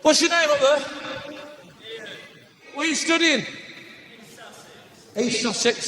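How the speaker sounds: ordinary delivery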